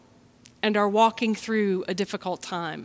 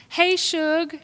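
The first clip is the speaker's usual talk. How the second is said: mom's greeting; 7 semitones higher than usual. Retold or imitated. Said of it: "she said".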